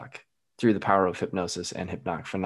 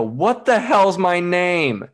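high, loud, drawn-out mock yell; freaked-out shout; 10 semitones higher, 7 dB louder, much slower than usual. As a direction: wail it out slowly in comic panic. Retold freak-out under hypnosis.

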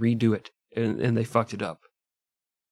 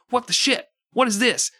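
shocked outburst; 12 semitones higher, 3 dB louder than usual. Retold or imitated. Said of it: "the other guard's like".

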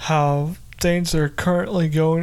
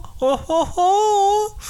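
villain laugh; 14 semitones higher, much slower than usual. Acted.

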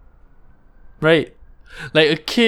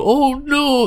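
mock alarmed parent. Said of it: "you go".

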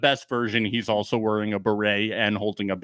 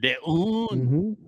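mock French accent; 4 semitones higher than usual. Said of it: "I'm losing my accent".

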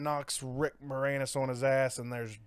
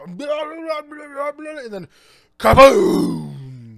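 imitated explosion; 9 semitones higher, 10 dB louder, much slower than usual.

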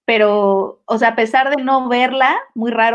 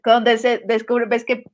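ordinary talk

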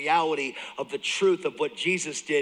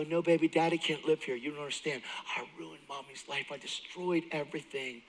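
much softer, fading remorse; tender to daughter; 7 dB quieter than usual. Retold or imitated. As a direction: hush it apologetically to a child. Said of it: "I go".

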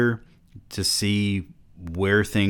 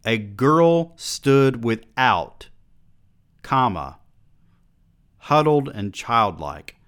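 pointed slow quote; slow read-back; slower than usual. Shown as a demonstration. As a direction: spell out the odd phrasing slowly. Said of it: "kinda thought I did at first".

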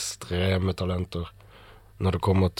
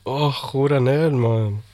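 exasperated; 5 semitones higher, 5 dB louder, slower than usual. Real, not acted. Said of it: "[name] står der og skriker".

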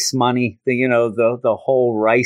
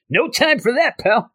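high-pitched acted dismissal; hurried brush-off; 11 semitones higher than usual. Peter running by people inviting him, high acted brush-off. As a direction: call it out in passing.

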